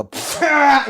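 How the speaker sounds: dismissive pfft. Retold or imitated. Sergeant passing the recruit's bunk, scoffing. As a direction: scoff him off. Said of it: "he'd look at me and go".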